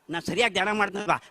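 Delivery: usual speaking voice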